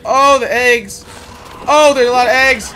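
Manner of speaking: loud, high alarmed outburst; freaking out. Real, not acted. Player spots a lot of eggs, freaking out. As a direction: cry out in real panic. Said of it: "I probably shouldn't be freaking out like that".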